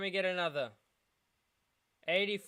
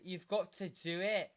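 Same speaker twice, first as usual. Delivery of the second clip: ordinary talk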